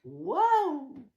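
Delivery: loud, drawn-out waouh; excited wow. Shown as a demonstration. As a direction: belt out the waouh. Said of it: "On peut dire deux waouh".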